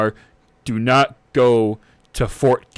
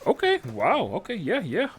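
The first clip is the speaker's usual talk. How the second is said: high, softened, nervous acknowledgement; intimidated captain; 9 semitones higher, 7 dB quieter than usual. Acted. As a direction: back down in a small, high voice. Nervous captain reacts to drawn sword.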